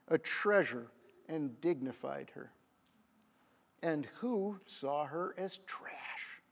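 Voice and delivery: slow, soft, sinking to a whisper; quiet, weighty rhetorical question; solemn, hushed. Real, not acted. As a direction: let the question fade into a grave whisper. Solemn climax of the moral.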